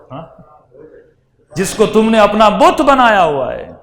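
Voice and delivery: ordinary talk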